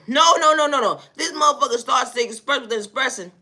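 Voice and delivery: ordinary talk, nothing marked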